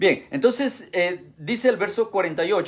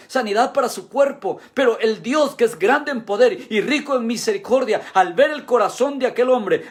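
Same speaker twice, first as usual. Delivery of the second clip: raised pitch, building sermon cadence; impassioned preaching; 6 semitones higher, 4 dB louder than usual. Real, not acted. Preacher explaining the blind man's unexpected salvation, impassioned. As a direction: preach it in insistent, rolling phrases.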